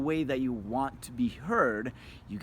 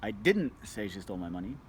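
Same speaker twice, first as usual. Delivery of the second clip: didnt hit hard, rest of the line light; stress on DIDN'T; 5 semitones lower than usual. Shown as a demonstration. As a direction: lean on the word didn't. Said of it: "seven different meaning ... word you emphasize".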